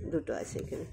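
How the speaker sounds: quiet whispered aside; whispered repeat. Shown as a demonstration. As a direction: whisper it back to the class.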